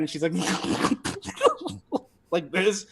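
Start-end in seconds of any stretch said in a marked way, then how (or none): 0.4-2.0 giggle. Acted. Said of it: "she giggles as you kiss her hand".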